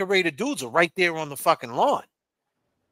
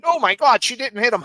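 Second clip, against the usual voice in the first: mimicking; 6 semitones higher, 5 dB louder than usual. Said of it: "mimicking Gladys".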